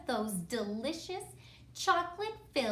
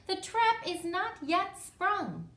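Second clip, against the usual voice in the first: a touch of high-pitched dinosaur rebuke; gently hammed-up warning to a partner; higher storybook character voice; lightly voiced scolding dinosaur; 8 semitones higher than usual. A narrator's reading.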